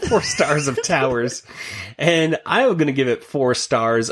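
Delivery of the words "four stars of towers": laughing as he says it; laughing repeat. Real, not acted. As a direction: chuckle through the words. Repeats joke while laughing.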